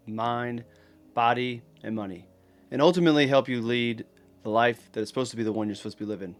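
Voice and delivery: ordinary talk, nothing marked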